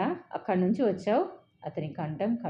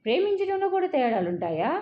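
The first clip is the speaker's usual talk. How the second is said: higher, louder, intense challenge; intense question; 8 semitones higher, 5 dB louder than usual. A narrator's reading. Boyfriend to girlfriend, intensely demanding. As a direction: press the question hard and loud. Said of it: "అతను తీవ్రంగా అడిగాడు".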